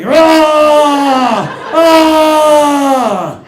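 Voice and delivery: loud, high, laughing monster roar; a hammed-up beastly roar, Wookiee style. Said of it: "you just hear me".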